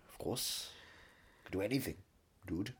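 ordinary talk

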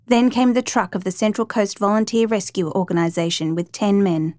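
plain reading voice

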